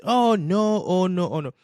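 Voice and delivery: high, loud mocking "oh no" chant; hammed-up alarm at the topic; mock panic. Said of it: "But when people talking about this".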